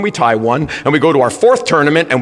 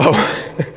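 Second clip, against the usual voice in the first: a quick breathy laugh at his own story; short laugh; 4 dB quieter than usual. Real, not acted. Coach laughing at his own baby story to the crowd. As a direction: let a little laugh slip out before going on.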